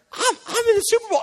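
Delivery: high, fast, hammed-up thrill; mock giddy amazement, voice shooting up; starstruck excitement. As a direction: gush like an overawed player. Player thrilled to be at game.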